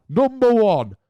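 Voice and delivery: a playful hammed-up reveal; big announcement. Shown as a demonstration. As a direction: build it like a game show reveal.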